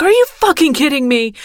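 mock furious are you fucking kidding me; exasperated outburst. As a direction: imitate a man losing patience. Stranger exasperated at closed office.

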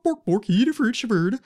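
high, loud mock Swedish gibberish; Swedish Chef. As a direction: bork it like the Muppet chef. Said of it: "we think it's the Swedish chef".

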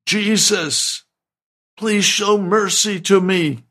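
acted cry for mercy, pitched well up. Blind beggar to Jesus on the road, crying out. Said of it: "began to cry out and say".

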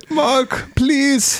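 high mock pleading; hammed-up plea for help over the phone; Heinrich. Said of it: "he calls Mark, and he's like".

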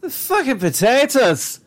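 exaggerated Irish accent, mock indignant; bad Irish accent. Co-host voices Irish protest about potatoes, bad Irish accent. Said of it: "the worst Irish accent right now".

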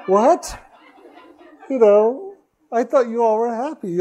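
high, loud, laughing disbelief; retold shock. Friend hears of client's unhappy marriage, mock shocked. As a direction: act out a stunned "what?", pitch jumping.

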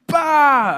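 sudden high loud "bah"; startling someone in a dark house; mock jump-scare "bah". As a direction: pop out with a loud boo. Family member jumps out.

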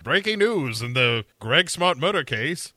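put-on news bulletin voice; TV news anchor. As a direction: announce breaking news like a hammy anchor. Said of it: "on the news, it's like".

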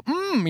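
a high, soft, closed-mouth "mm" with lips pulled in; lips-sucked hum. Retold or imitated. Dad dodging his son's kiss, a high tight hum. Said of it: "I go".

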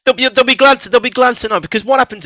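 excited. Real, not acted. Heated rebuttal to co-host.

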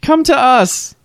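high, hesitant mock call. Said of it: "they're like".

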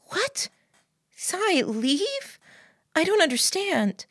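high-voiced bewilderment; bewildered girlfriend. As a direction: question it in a high woman's voice, confused.

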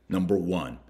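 normal talking voice

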